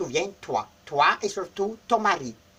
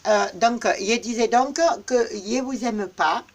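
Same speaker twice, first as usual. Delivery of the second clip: ordinary talk, nothing marked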